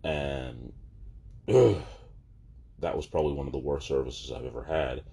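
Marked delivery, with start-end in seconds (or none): 1.5-1.8 loud high disgusted groan; loud ugh. Real, not acted. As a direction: blurt out a real groan of disgust. Disgust recalling bad service.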